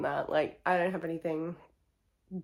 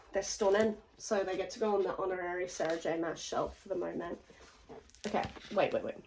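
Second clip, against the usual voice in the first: ordinary talk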